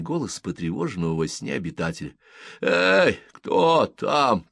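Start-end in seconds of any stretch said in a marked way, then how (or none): 2.6-4.5 high, loud call, lightly playing a disturbed sleeper; sleepy grumbling resident. A narrator's reading. Woken resident calls from his window, loud sleepy grumble. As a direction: call out crossly as if half asleep. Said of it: "промямлил сонный жилец".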